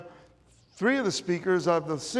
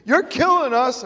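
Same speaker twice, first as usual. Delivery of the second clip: a loud mock yell from passers-by; hammed-up retold shout of complaint; 7 semitones higher, 8 dB louder than usual. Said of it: "people would scream".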